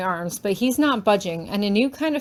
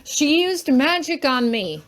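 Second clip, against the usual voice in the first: yelling; 5 semitones higher, 3 dB louder, slower than usual. Acted. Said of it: "he yells".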